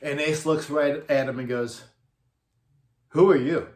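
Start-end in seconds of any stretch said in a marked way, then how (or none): 3.1-3.7 loud, blank question to a stranger; clueless. Retold or imitated. Ace to his own bass player after the show, clueless. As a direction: imitate a star with no idea who this is.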